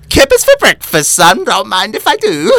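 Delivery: hammed-up masked villain impression; Bane voice. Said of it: "Just trying better voices".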